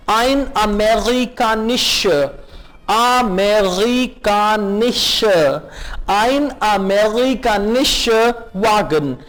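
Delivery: slow, word by word for the class to copy; slow drill. Shown as a demonstration. Teacher spelling out a German phrase for learners, slow and careful. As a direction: break amerikanischer into clear slow beats. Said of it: "repeat कीजिए".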